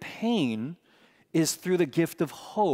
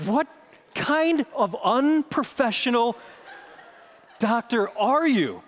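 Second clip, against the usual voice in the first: higher, slow incredulous protest; slowed, raised mock outrage; indignant outburst; 7 semitones higher than usual. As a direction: scold the doctor in disbelief. Outraged at doctor's joke.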